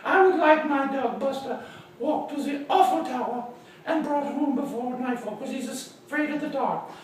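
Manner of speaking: high-pitched put-on lady's voice; high character voice for a lady client; Missus Baumgarten. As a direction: play the dog's owner, pitched well up. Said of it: "And she said".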